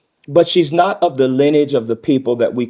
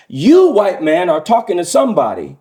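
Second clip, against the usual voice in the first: raised, emphatic mock confrontation; interpreted indignant man; 5 semitones higher, 4 dB louder than usual. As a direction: voice a proud man setting someone straight. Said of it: "this is my interpretation".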